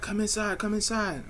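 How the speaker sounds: sing-song beckoning in a high voice; imitating the girls. Girls calling him inside.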